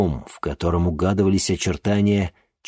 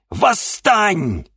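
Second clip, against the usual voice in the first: a final shouted Восстань; shouted command; 12 semitones higher, 4 dB louder than usual. Acted. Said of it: "крикнула ведьма".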